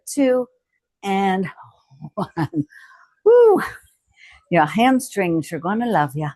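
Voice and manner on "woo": high loud celebratory whoop; excited whoop. Real, not acted. Trainer cheering the class after the last rep. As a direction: whoop at the finish.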